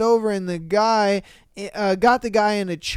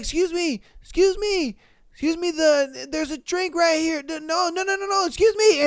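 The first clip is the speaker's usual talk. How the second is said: high, flustered would-be helper; mock urgent warning; 7 semitones higher than usual. Bystander warns dancer about spilled drink, mock urgent. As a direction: imitate someone anxiously trying to get attention.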